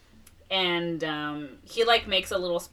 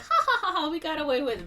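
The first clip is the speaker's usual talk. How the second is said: mock gloating; 9 semitones higher, faster than usual. Couple gloating after the ruse.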